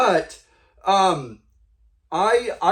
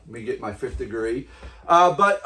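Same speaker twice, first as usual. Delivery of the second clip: ordinary delivery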